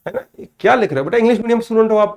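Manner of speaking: ordinary delivery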